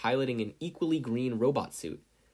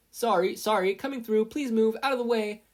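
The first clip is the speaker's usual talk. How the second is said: much higher, louder hurried apologies; calling out; 12 semitones higher, 7 dB louder than usual. A narrator's reading. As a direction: push through calling out. Pushing through a crowd.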